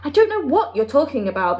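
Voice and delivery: getting impatient with the Queen; King of Sweden losing patience; testy. A narrator's reading. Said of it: "growing testy".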